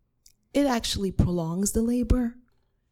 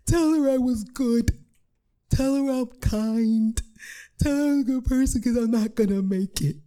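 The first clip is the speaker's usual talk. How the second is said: hammed-up hysterical deathbed pleading; hysterical crying; 5 semitones higher, 3 dB louder than usual. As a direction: wail a last plea through mock sobs. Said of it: "I'm crying now. I'm hysterical".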